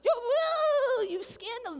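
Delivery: high, loud, panicked parent voice; panicked parents hearing shocking news; freaking-out parents. Retold or imitated. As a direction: shriek it like parents losing it. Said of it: "They're, like, freaking out".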